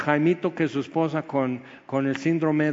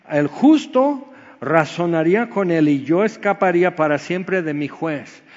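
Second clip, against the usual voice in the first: emphatic scripture reading; 4 semitones higher, 7 dB louder than usual. Acted. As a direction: declaim Job's words. Reads scripture aloud, emphatic. (lectura bíblica enfática)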